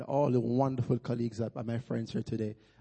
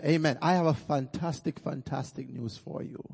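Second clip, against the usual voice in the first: ordinary talk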